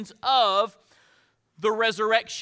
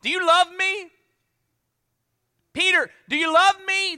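higher and louder, pressing; pleading question; 8 semitones higher, 6 dB louder than usual. Retold or imitated. Jesus pressing Peter a second time, loud and pleading. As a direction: call out the question, urging him.

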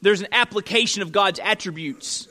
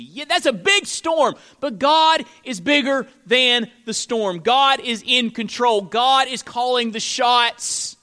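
raised, insistent pulpit voice; higher, emphatic sermon delivery; forceful preaching; 5 semitones higher, 5 dB louder than usual. Acted. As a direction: preach with rising conviction, punching key words.

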